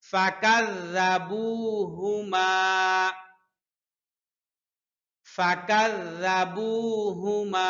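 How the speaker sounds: ফাকাযযাবুহুমা stretched out slowly with rounded lips on হু; slow careful recitation. Shown as a demonstration. Teacher models the word for the class, lips rounded. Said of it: "teacher models Quran recitation".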